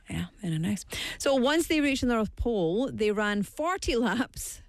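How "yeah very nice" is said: ordinary delivery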